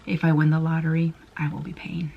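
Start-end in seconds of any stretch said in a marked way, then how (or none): 1.4-2.1 whisper. Real, not acted. Joking aside about lottery.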